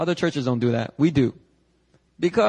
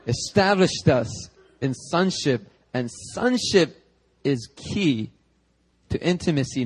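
slow preaching emphasis; slower than usual. Real, not acted.

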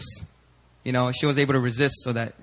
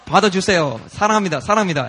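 higher, insistent declaration of love; retold heartfelt urging, raised voice; earnest pleading; 5 semitones higher, much faster than usual. Urging God's love on the girl.

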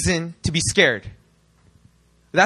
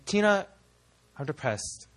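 mopey confession; 5 semitones lower, 8 dB quieter than usual. Retold or imitated. Confiding in small group leader Tina, soft and mopey. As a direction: pour your heart out in a drooping voice.

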